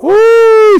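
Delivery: high, loud "woo"; long high-pitched whoop of surprise. Real, not acted. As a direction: let out a sharp rising whoop. Reacts to agitated dog.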